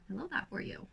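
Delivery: soft, offhand approval; quiet little word of approval; soft aside. Real, not acted. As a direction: say it under your breath, a touch softly. First take of repeated catchphrase.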